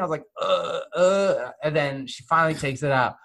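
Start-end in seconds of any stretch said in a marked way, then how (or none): 0.4-1.5 pained grunts. Retold or imitated. Swab deep in nose.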